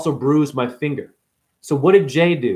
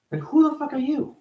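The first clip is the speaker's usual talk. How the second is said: incredulous; 11 semitones higher, 4 dB quieter than usual. Retold or imitated. Annoyed at nosy strangers.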